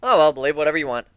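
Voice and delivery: ordinary delivery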